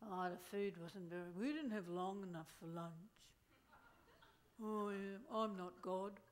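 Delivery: low, soft grumbling moan; soft low whinge; whining complainer. Retold or imitated. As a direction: mope about lunch like a drain. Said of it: "One fuffer to be going".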